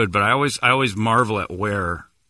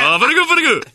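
high, loud mock Chinese accent; exaggerated Chinese-accent caricature, pitched way up; Don Yi; 6 dB louder, faster than usual. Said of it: "I think that's how he sounds".

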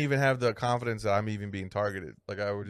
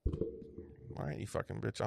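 whispered insult; 4 semitones lower, 15 dB quieter, much slower than usual. Retold or imitated. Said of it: "leaving in a huff".

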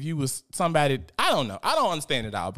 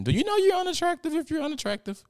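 whole question much higher, incredulous; faster than usual. Real, not acted. To viewers, mocking the question in high disbelief. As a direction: fling it up in disbelief.

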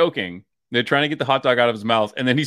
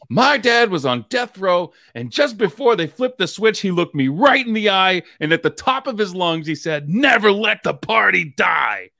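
dramatic eulogy quote; 6 semitones higher than usual. Retold or imitated. Quoting sketch dad speech, dramatic.

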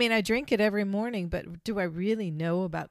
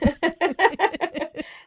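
loud giggling laugh; burst of laughter; 8 semitones higher, 6 dB louder than usual. Real, not acted. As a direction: laugh out freely. Laughs at her own idea.

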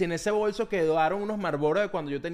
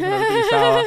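laughing; 8 dB louder than usual. Real, not acted. Laughing about the lost knife.

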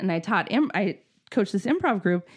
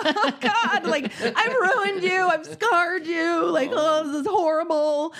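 high, slowed, dismayed wail; hammed-up guilt at scarring your kid; mock horror; 9 semitones higher, slower than usual. Mom retelling her guilt to her son, high dismayed wail. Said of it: "I was like".

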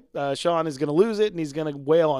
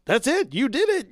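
bright, much higher burst of delight, imitating him; happy and thrilled; 8 semitones higher than usual. Jimmy proudly praising Sean for walking away, imitated with joy. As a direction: cheer him on like a proud friend. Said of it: "so happy and so thrilled about it".

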